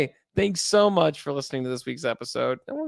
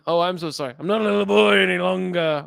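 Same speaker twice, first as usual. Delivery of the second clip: mock trespasser apology; 4 semitones higher, 4 dB louder than usual. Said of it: "You're just walking on his lawn".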